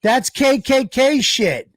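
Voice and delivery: high, drawn-out accusation; real indignant exclamation, stretched out; indignant outburst. Real, not acted. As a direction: cry it out slowly in high-pitched alarm. Repeats the accusation, protesting.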